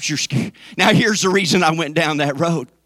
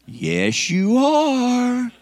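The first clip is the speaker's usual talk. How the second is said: teasing retort; 7 semitones higher, much slower than usual. Real, not acted. Teasing retort to imitated denial.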